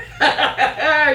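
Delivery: high, loud cackling laugh; gloating witch cackle. Acted. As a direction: cackle down at them like a hag. Witch laughs at trapped knights.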